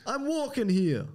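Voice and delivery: high, slow, stretched New York complaint; mock New York accent. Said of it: "I think it's New York".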